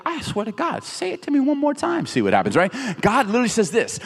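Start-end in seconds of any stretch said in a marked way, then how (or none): 0.0-2.6 high, strained warning dropping at the end; mock threat. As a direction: play-act losing patience. Acts own threatening reply.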